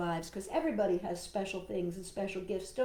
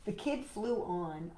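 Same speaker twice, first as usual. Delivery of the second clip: plain reading voice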